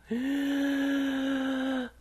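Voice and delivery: high, long breathy gasp of awe; amazed gasp. Shown as a demonstration. Teacher acting out shock at a staggering amount. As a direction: gasp as if stunned by a huge pile. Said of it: "이게 staggering이에요".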